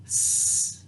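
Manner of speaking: S as a soft unvoiced hiss, no voice; whispered letter sound. Shown as a demonstration. Teacher sounds out S for the spelling word. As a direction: breathe out a quiet sss. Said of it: "And the next sound would be".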